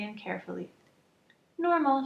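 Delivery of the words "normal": high, loud announcement; announcing. Acted. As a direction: announce the result proudly. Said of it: "she announced".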